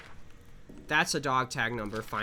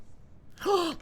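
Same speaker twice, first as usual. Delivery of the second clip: quick high startled gasp; a sharp startled intake of breath. Real, not acted. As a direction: catch your breath at a sudden noise. Startled by a sound.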